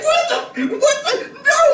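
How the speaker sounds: very high, loud frightened yelp; high laughing panic at a drawn gun; panicked shriek. Retold or imitated. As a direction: shriek it in sudden terror.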